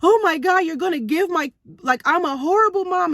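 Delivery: half-hearted retold wail; retold bawling. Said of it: "I just started bawling".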